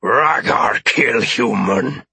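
slow, forceful alien growl; drawn-out alien menace; alien's threat. Acted. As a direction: threaten slowly as a cornered alien. Alien threatens to kill hostage.

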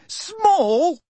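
shrill disbelief. A narrator's reading. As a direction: squeak "Small?" in outraged disbelief. Hermione echoing louder, higher.

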